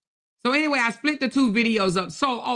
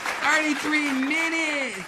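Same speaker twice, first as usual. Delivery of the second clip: delighted; 7 semitones higher, slower than usual. Real, not acted. Savouring the fast goal.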